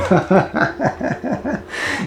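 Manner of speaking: high, breathy fake laughing fit; mock helpless laugh; demo laughing fit. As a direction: act out laughing till you cry. Imitating laughing till crying.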